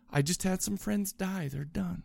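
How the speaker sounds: soft, slowed thought, dropping away; quiet inner thought. Retold or imitated. Host quoting his thoughts on the show's deaths, hushed. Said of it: "at the same time as thinking".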